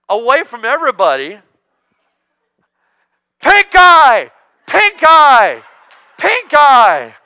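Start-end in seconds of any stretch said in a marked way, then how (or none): 3.4-7.1 very loud, high, mock-alarmed yell; comic shouted announcement of pink eye; shouting a warning. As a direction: bellow it like a leper's warning. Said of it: "Now imagine if the same applied".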